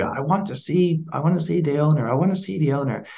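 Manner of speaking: a hint of an offended posh customer; angry posh customer demands to see the owner, voiced high; angry posh lady. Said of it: "And she's angry".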